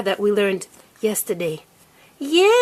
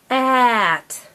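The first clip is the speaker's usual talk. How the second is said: "at" with a long held vowel; said slowly. Shown as a demonstration. Teacher modelling a word for a child, said slowly. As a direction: stretch "at" out slowly. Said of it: "Your turn to say the word slowly".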